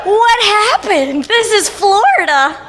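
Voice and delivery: high, slow disbelieving whine; half-joking outrage at the weather; mock dismay. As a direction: act baffled by the rain. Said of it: "And I was like".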